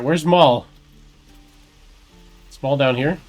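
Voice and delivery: ordinary delivery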